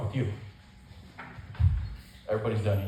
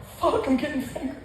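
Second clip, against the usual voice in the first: squeaky mock panic, pitched way up; high panicked squeak; 12 semitones higher than usual. Teen boy dreading the doctor, squeaky mock panic. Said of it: "I'm like".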